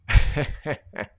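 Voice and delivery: a short breathy burst of laughter; sudden laugh. Real, not acted. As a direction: laugh out at something off-screen. Breaks off, laughs at screen.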